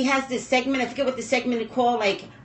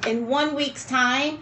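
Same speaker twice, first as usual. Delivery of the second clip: usual speaking voice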